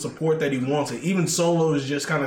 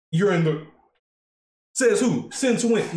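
higher, rising disbelief; incredulous; 5 semitones higher than usual. Retold or imitated. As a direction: throw it back as a stunned challenge. Roman reacting in disbelief.

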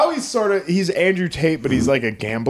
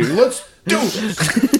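put-on slow, drawn-out half American half British accent, higher and laughing; 7 semitones higher, much slower than usual. Cohost hyping up as Andrew Tate, laughing half American half British accent. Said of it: "in my weird fucking half American, half British accent".

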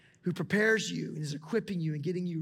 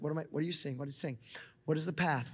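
ordinary delivery

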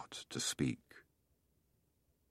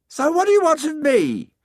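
the sun speaking, much higher and louder; lofty voice of the sun, pitched way up; warm beaming sun voice; 7 dB louder than usual. Acted. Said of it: "the sun asked".

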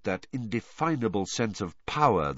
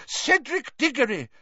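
very high, outraged protest; high-pitched furious argument, building louder; crying out angrily; 5 dB louder than usual. A narrator's reading. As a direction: cry out each name in anger. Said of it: "Professor McGonagall cried".

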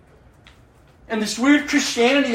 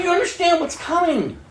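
ordinary talk

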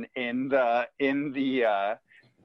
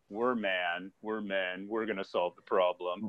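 slightly mock-manly, pitched low; lightly mimicked manly boys; 6 semitones lower, 4 dB quieter than usual. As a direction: lightly imitate boys acting like men, voice dropped. Boys playing men solving the crisis.

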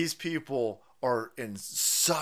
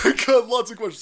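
laughing; 8 semitones higher, 10 dB louder than usual. Retold or imitated. Repeat while laughing.

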